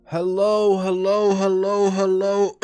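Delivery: high, loud repeated hellos; loud calling out. Real, not acted. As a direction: call out hello like a greeting. Streamer opening greeting call.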